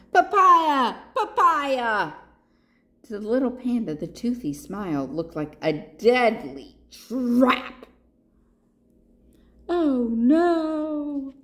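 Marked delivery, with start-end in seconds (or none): 0.1-2.1 loud, high repeated call; crocodile calling out. A narrator's reading. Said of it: "the crocodile calling out". 9.7-11.3 high, long-held oh no; dismayed cry. A narrator's reading. Storyteller's cry at danger.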